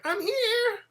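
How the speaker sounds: very high, drawn-out squeal; exaggerated high-pitched mock caller; imitated caller. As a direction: mimic her in a shrill stretched-out call. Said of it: "She's like".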